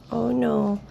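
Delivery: plain reading voice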